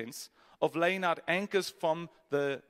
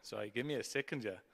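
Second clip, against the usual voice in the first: mock aside; 6 semitones lower, 9 dB quieter than usual. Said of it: "this demon's arisen".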